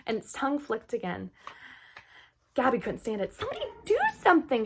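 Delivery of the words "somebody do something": high, fast pleading; begging. Acted. Gabby begging someone to save the fairy. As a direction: beg desperately for help. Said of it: "she begged".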